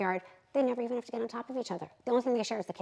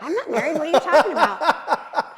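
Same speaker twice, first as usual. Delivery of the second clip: higher, louder, laughing and slow denial; nervous laugh; 6 semitones higher, 11 dB louder than usual. Retold or imitated. To the man-hating client, laughing innocent denial. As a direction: fake innocence through a laugh.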